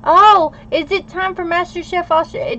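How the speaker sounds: high, loud burst of delight; light up at the thought; excited. Real, not acted. Suddenly remembering her favourite cooking show, excited and high.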